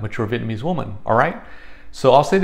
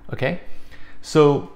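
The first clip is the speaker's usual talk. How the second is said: ordinary talk, nothing marked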